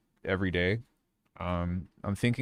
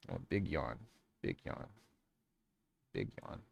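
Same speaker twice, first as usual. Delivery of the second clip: whispered coo; 6 semitones lower, 17 dB quieter than usual. Real, not acted. Cooing at yawning dog.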